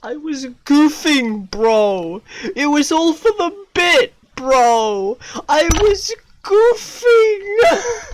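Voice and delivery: high, loud, whining excuse; a shaken laughing claim of goofing; shaken protest. Real, not acted. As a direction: plead it was a joke, rattled. Excuse after being scared.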